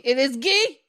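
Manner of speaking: imitated angry yell. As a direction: yell it like an irate caller correcting someone. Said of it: "the guy on the phone yelled at me".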